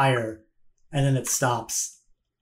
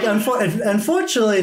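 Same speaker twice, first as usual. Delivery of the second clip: merchant's line pitched higher; lightly voiced merchant; 6 semitones higher than usual. Game master as merchant to visitors, lightly voiced, higher. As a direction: lift the voice lightly for her. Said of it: "she's like".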